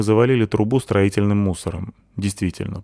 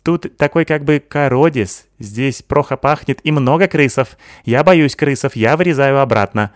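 put-on higher, lisping foreign girl voice, excited; Sykil's accented voice; 6 semitones higher, 6 dB louder than usual. Sykil praising Natasha, lisping accented girl voice.

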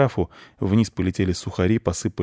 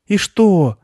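high, louder burst of impatience; impatient listener butting in; impatient outburst; 12 semitones higher, 6 dB louder than usual. A narrator's reading. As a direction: blurt it out, unable to wait any longer. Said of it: "не выдержал Марк".

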